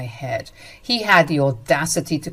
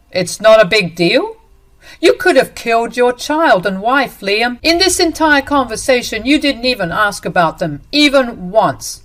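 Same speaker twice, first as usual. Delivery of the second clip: higher and louder, incredulous; outraged disbelief; 5 semitones higher, 6 dB louder than usual. Acted. Dante can't believe Liam's excuse, higher and louder.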